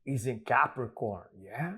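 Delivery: playing a Chicano uncle with easy swagger; Uncle Frankie impression. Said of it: "my Uncle Frankie".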